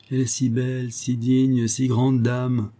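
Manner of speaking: high, slowing mock adoration; imitating besotted admirer. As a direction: draw out each praise like a lovestruck man. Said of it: "C'est ainsi qu'il parle". (imitation aiguë et lente d'un amoureux béat)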